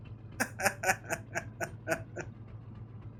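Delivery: quiet, voiceless trailing laugh; spent wheezy laughter. Real, not acted. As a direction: keep laughing with little voice left. Still laughing at the name.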